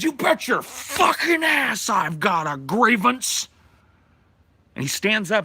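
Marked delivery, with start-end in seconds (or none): 0.0-3.5 high, loud, slow bellow; angry Santa shouting his grievance; furious shout. Retold or imitated. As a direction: yell it across the meeting table. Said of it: "and he's pissed".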